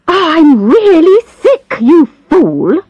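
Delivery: high, tearful angry outburst at the doctor; sick old woman angrily scolding her doctor. A narrator's reading. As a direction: snap at him, a touch of tears in it. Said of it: "said Missus Blakemore angrily".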